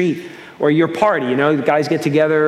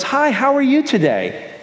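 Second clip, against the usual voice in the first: chirpy greeting; 9 semitones higher than usual. Retold or imitated. Friendly stranger greeting in store.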